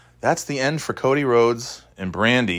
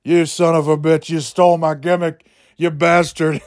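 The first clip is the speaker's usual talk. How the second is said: higher, mock-angry wrestler voice; Stone Cold Steve Austin; 6 semitones higher than usual. Prankster accuses a fellow wrestler of theft, mock-angry. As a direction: cuss him out as a put-on wrestler. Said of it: "pretend to be Stone Cold Steve Austin".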